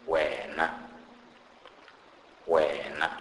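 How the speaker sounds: "wena" with W as in "wow"; example word demo. Shown as a demonstration. Teacher gives an example for W, careful "wena". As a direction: say "wena" carefully, long first vowel. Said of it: "pronounced like the W in the word wow".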